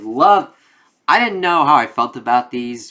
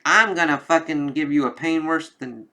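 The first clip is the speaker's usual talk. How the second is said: higher-pitched mock anger; mildly hammed-up pissed threat; pissed-off villain; 6 semitones higher than usual. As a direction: act out an irritated villain's threat. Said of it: "now he's pissed".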